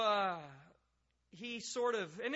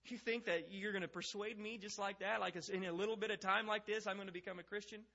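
raised-pitch incredulous question; snide; 4 semitones higher than usual. Acted. Agrippa brushing off Paul's attempt to persuade him, snide. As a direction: scoff at the very idea, ending on a rise. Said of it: "kind of a snide remark".

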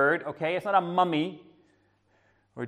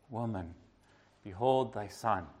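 gentle; 5 semitones lower, 8 dB quieter than usual. Pretend. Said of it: "gracious Lord".

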